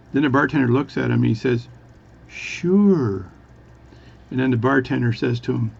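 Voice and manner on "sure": one long drawn-out word, high and loud; really slow speech. Acted. Bartender answering the Newfie, really slowly. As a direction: stretch it right out. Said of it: "is speak really slowly".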